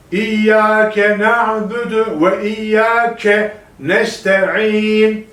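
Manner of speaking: İyyâke with the doubled yy hit hard, chanted slowly; stress on iyya. Shown as a demonstration. Teacher drills students on striking the iyya. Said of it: "İyyeye vuruyoruz burada".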